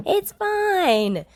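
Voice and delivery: cheering. A narrator's reading. Yamada cheers to June, high and loud. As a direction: cheer it happily. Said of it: "Yamada cheers".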